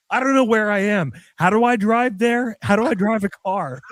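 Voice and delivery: high, mock-confused driver; dazzled driver. Joking about being blinded by gold teeth, high and dazed. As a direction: play the blinded customer, high and lost. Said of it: "you're like".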